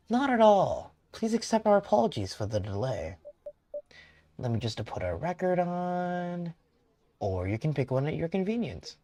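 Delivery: a hint of a courteous elderly host; older man character. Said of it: "he is old".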